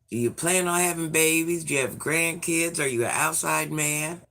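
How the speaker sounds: normal talking voice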